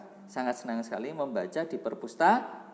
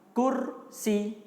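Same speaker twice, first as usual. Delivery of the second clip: high, drawn-out model word; sounding out words; 9 semitones higher than usual. Shown as a demonstration. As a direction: call the word out for the class to repeat. (mengeja kata untuk ditirukan)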